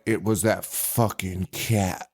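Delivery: low, slow mock mom voice; mom quote. Mom blaming the cat, low and slow. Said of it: "the mom is like".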